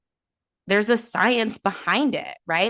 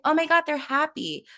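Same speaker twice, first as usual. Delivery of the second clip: put-on much higher gushing coo at a baby; cooing parent; 9 semitones higher than usual. Parent gushing at a smiling baby, much higher. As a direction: delight over the baby's smile.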